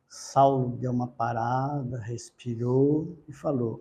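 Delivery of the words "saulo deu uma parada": plain reading voice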